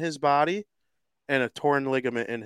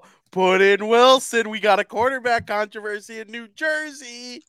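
higher, louder, mock-panicked fan; overreacting fans; 8 semitones higher, 4 dB louder than usual. Mocking Jets fans after a Rodgers pick, hammed-up panic. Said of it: "they'll be like".

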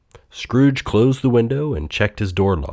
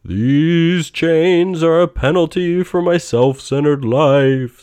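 loud, high, slow spectral voice; booming ghost; 8 semitones higher, 5 dB louder, slower than usual. A narrator's reading. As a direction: boom it out like a phantom. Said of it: "said the ghost".

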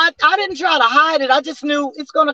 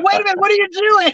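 higher-pitched mock astonishment; startled therapist; 8 semitones higher, faster than usual. Therapist hearing her patient suddenly scat, imitated astonishment. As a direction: imitate someone taken aback, pitched up.

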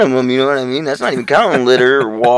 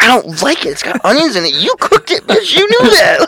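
put-on much higher whining child voice; whiny kid; 14 semitones higher than usual. Retelling refusing mom's food, whiny high kid. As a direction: whine like a picky kid at dinner. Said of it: "I'm like".

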